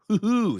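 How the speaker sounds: playful high hoo-hoo; high amused hoot; delighted hoot. Real, not acted. Delighted reaction to sauce.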